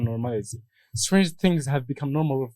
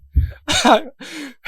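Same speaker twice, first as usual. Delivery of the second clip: laugh; 12 semitones higher, 6 dB louder than usual. Real, not acted. Laughs after own impression.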